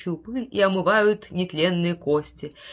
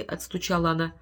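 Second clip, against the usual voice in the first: plain reading voice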